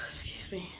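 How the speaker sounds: tearful whisper; crying. Real, not acted. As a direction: choke up and whisper it.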